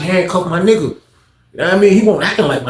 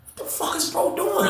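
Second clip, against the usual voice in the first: stunned disbelief; 9 dB quieter than usual. Retold or imitated. Narrator stunned watching standoff.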